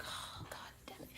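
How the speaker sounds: voiceless whispered swear; whispered curse. Retold or imitated. Watching the kid run down the hallway, whispered curse. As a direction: hiss the curse under the breath.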